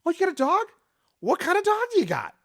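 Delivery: high, bright-eyed gushing over their dog; excited dog chat. Shown as a demonstration. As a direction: gush like you just met a dog lover. Salesman demo of small talk.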